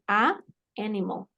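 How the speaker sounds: "a animal" with a break between the two vowels; pausa entre vocales. Shown as a demonstration. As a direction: stop after "a", then start "animal". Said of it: "van a hacer una pausa". ("a animal" con pausa entre las vocales)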